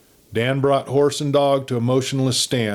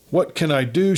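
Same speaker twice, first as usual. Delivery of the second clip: higher, urgent pleading; desperate plea; 7 semitones higher than usual. A narrator's reading. Kate pleads desperately with Dan for her father. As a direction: beg him, helpless. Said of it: "she pleaded desperately".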